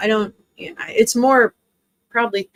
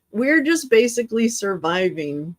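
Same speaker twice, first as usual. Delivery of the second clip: ordinary talk, nothing marked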